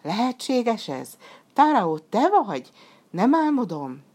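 high, incredulous questions; amazed. A narrator's reading. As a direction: ask as if you can hardly believe it. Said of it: "kitágult az ámulattól".

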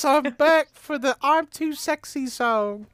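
put-on [name]. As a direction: coo it like a nineties pop idol. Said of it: "it's [name] again".

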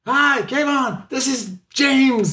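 high straining mock caller; mock confused caller. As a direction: play a caller who can't be heard. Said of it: "The person goes".

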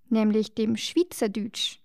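ordinary talk

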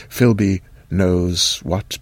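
usual speaking voice

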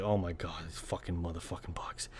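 low, whispered cursing; barely voiced, acted grumble at pests; hushed exasperation. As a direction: mutter it under your breath. Acts out muttered reaction to bugs.

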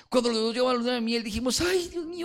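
hammed-up high sugary pastor bragging; mocking the pastor. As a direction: play the prim pastor boasting of his perfect marriage. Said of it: "él comenzó a desarrollar a hablar".

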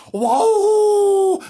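high, loud, drawn-out howl; dog howl. Shown as a demonstration. Narrator showing the children what a howl is, loud dog howl. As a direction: hold a big high hound howl. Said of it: "that's a howl, that is".

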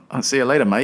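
lightly attempted Australian accent; joky, half-hearted Aussie twang; bad Australian accent. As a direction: have a clumsy go at Australian. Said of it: "that's a horrible accent".

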